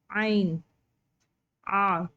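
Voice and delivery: Arabic ain from the throat; throaty ain. Shown as a demonstration. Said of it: "हलक के निचले हिस्से से".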